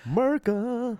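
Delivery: high, drawn-out "America", slurred into "Murca"; mock-patriotic. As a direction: parody a flag-waver's "America", first syllable swallowed. Mocking the film's forced patriotism.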